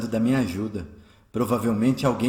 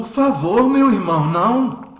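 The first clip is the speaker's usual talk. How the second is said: anguished plea; 7 semitones higher, 8 dB louder than usual. Acted. Eurípides refuses friend's murder offer.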